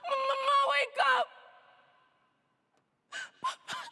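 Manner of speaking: high sobbing screams; terrified screaming. Acted. Girl screaming for her dead mama and her papa, terrified. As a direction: scream for your life.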